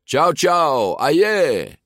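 high, loud greeting to a crowd; showy calling out. A narrator's reading. Ercole greets the parting crowd, showy calling out. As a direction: call it out with swagger.

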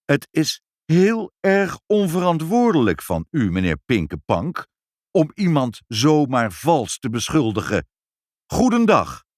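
loud, high indignant reprimand; outraged parting rebuke; calling out indignantly. A narrator's reading. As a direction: scold emphatically, ending on a loud parting shot. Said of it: "draaide hij zich nog even om en riep".

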